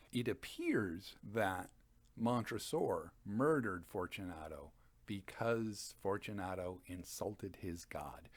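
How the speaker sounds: ordinary delivery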